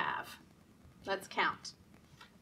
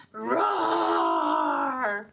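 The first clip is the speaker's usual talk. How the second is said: big low roaring lion; 7 dB louder than usual. Pretend. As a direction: roar like a lion. Book animal sound.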